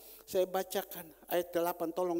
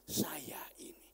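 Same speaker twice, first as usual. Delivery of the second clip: unvoiced, hushed admission; soft whispered confession; 2 semitones lower, 4 dB quieter, slower than usual. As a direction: whisper it, owning the fault. David confesses quietly.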